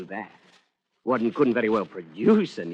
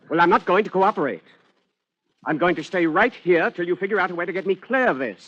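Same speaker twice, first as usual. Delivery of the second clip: louder, defiant refusal; 7 semitones higher, 4 dB louder than usual. Acted. Fugitive refusing to leave the flat, loud and defiant. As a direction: dig in and refuse.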